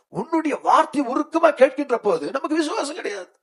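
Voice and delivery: very high-pitched, urgent exhortation; fervent preaching. Real, not acted. Preacher asking if the Lord listened earnestly, fervent high cry. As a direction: drive each question home high and fervently.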